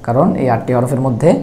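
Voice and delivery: normal talking voice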